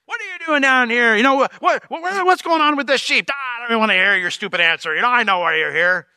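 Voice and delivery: high, loud mock tirade that gradually drops; acted exasperated older brother; impatient scolding. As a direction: cut off any answer, stumbling over the questions. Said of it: "don't even give him time to answer".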